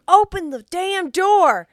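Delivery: loud, drawn-out mock southern yell; southern accent. Man beating on a bathroom door, yelling in southern accent. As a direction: holler it high in a southern drawl. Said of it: "I don't know why I'm doing a southern accent".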